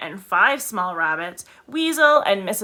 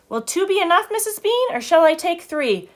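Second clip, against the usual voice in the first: Mabel voice; 9 semitones higher than usual. A narrator's reading. Mabel asks Mrs Bean, high voice.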